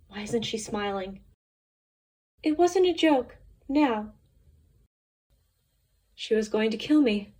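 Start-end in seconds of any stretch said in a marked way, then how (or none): none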